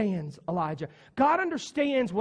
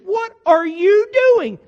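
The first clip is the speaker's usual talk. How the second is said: loud, high, incredulous telling-off; a friend yelling at Elijah to get up; scolding friend; 6 semitones higher, 7 dB louder than usual. Acted. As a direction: shout him out of his self-pity. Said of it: "we would have said".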